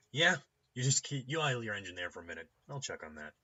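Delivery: gatekeeper starting loud, then easing off; brusque doorkeeper. Acted. Doorkeeper making a stranger wait at the door, brusque. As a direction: tell the visitor to wait, curtly. Intercom doorkeeper stalls visitor.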